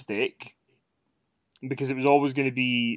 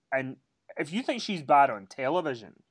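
usual speaking voice

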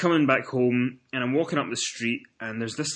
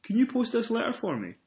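mock polite cyclist; 11 semitones higher than usual. Cyclist's deadpan punchline request.

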